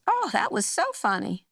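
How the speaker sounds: lightly voiced aunt, rising high and bright at the end; a hint of the sweet aunt gushing; mild imitation of a pleased aunt; aunt's cheerful insistence. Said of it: "She goes".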